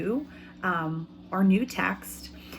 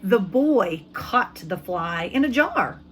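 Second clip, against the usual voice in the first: usual speaking voice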